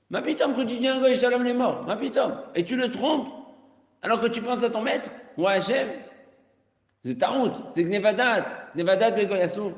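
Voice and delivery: high and loud, pressing every phrase; teacher condemning the trick, high and loud; indignant emphasis. Acted. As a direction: hammer home that it's forbidden. Emphatic ruling on deception.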